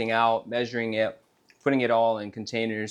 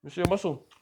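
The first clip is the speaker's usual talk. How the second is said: playful coaxing; 9 semitones higher, much faster than usual. Real, not acted. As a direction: tease him into flexing, playful and high. Coaxing a kid to flex.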